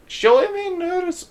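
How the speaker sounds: a clumsy try at a German accent; attempted German accent. Shown as a demonstration. Game narrator retries the line in a German accent. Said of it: "I gotta work on a German accent".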